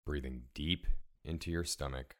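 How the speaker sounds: ordinary talk, nothing marked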